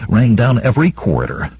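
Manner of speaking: ordinary delivery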